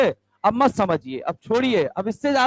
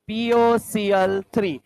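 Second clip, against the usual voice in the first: drawn-out answer; 2 semitones lower, much slower than usual. Shown as a demonstration. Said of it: "teacher stresses product".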